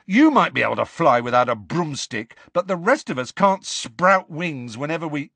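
barely contained anger. A narrator's reading. Ron to Luna, barely containing his anger. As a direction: let irritation build and get cut off. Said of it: "barely containing his anger".

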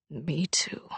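soft unvoiced whisper. Father whispers back to his crying daughter, whispering. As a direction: breathe it barely audible. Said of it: "he whispered".

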